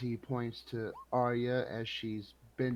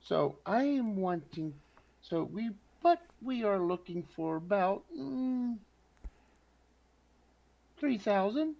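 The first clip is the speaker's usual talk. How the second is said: tentative haggling; 9 semitones higher than usual. Acted. Tentatively naming a price to merchant.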